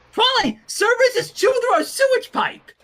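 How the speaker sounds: high, loud frantic report; high shouted emergency warning; panicked alarm. Acted. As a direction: burst in sounding the alarm.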